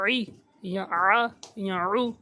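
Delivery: slow squeezed عی, آ, عو; tongue pulled back, strained vowels; slow pharyngeal vowel demo; squeezed throat. Shown as a demonstration. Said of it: "تو عی کی آواز نکل آئے گی".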